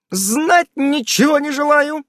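high, slow, snapping refusal; high final refusal; curt cutoff. A narrator's reading. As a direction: cut the talk short. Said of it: "отрезала Ульянка".

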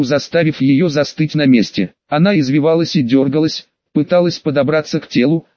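usual speaking voice

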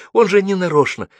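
высокая взволнованная мольба; вступись за него, умоляя. A narrator's reading. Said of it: "просила Алла". (умоляюще, с волнением)

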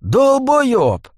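high, wailed insult; angry high-pitched yowl; exasperated howl. A narrator's reading. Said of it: "взвыл Дворф".

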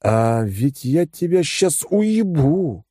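very slow, honeyed threat; sweetly menacing. A narrator's reading. Hero threatens the pedantic bear, slow and sweetly menacing. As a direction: purr the threat with mock tenderness. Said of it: "ласково произнёс я".

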